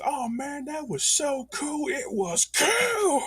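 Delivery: very high, slow, cracking yell; hammed-up ecstatic shriek; excited shout. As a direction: scream with delight at the screen. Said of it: "He says".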